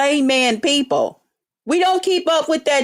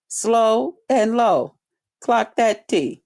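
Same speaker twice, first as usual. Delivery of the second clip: slow, lowered, deliberate demo; slow and low; 4 semitones lower, slower than usual. Shown as a demonstration. Coaching the rival how to talk, slow and low. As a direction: drop the pitch and draw it out.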